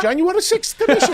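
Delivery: high Irish lilt, laughing; Irish accent. Shown as a demonstration. Showing that any line works with the Irish accent. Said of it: "So anything you say".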